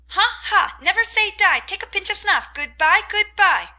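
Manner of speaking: high, loud parrot squalls; squalling parrot. A narrator's reading. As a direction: squawk it wildly like a parrot. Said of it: "squalled Polly".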